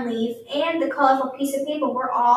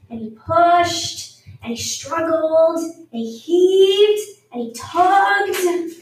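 slow and louder, each verb leaned on and rising higher; growing strain on pushed, struggled, heaved, tugged; straining effort; 4 semitones higher, 4 dB louder, much slower than usual. A narrator's reading. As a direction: push every verb out like heaving a weight. Building effort of pushing.

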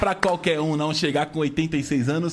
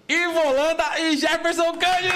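announcer call; 8 semitones higher than usual. Real, not acted. Announcing guests.

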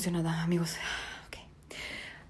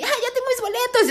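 much higher, put-on smug voice; mock the people who got tickets; smug lucky fans; 14 semitones higher than usual. Mocking lucky Twitter users, smug high voice. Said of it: "tres pendejos ahí".

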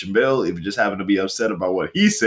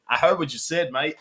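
higher-pitched imitation Australian accent; mock Australian accent; 5 semitones higher than usual. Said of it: "he's Australian".

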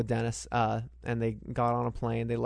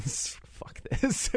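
whispered aside; 11 semitones higher, 4 dB quieter than usual. Retold or imitated. Dick lights a cigarette.

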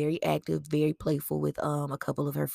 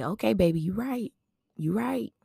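soothing; 5 semitones higher than usual. Retold or imitated. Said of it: "And I would just say".

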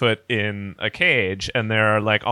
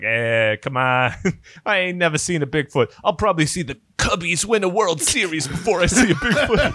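hammed-up Chicago accent, climbing in pitch; Chicago contractor; 6 semitones higher than usual. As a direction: do a sceptical Chicago working guy. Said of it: "Chicago general contractor who's like".

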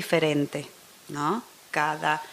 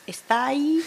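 put-on Chilean accent; 7 semitones higher than usual. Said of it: "en lugar de decir".

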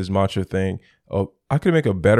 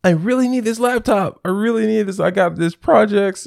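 much higher, pleading and frantic; freaking out; 13 semitones higher, 3 dB louder than usual. Retold or imitated. Begging the Apple rep for the laptop, freaking out. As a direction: beg like the laptop is your lifeline. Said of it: "And I was freaking out".

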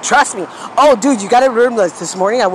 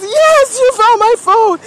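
high, loud, overjoyed and slowed; the owner rejoicing, high and loud; excited yes; 5 dB louder, slower than usual. Retold or imitated. Stranger thrilled to get his phone back, loud and high. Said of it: "He goes".

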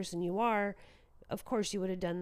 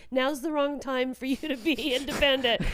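higher, teasing sing-song with a laugh; mock patronizing; 6 semitones higher, 7 dB louder than usual. Mother mimicking the family telling him to accept help, teasing. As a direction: play the fussing parent, half laughing.

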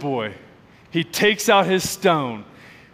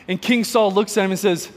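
ordinary delivery

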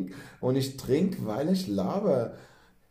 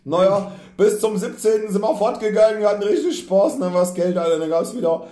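excited; 9 semitones higher, 10 dB louder than usual. Real, not acted. Excited memories of partying.

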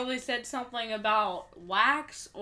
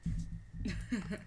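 fake laugh; 2 semitones lower, 8 dB quieter than usual. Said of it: "That sounds so fake".

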